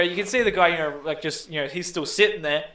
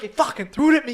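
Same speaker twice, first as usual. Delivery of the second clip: indignant retort; 9 semitones higher, 6 dB louder, faster than usual. Retold or imitated. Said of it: "He's like".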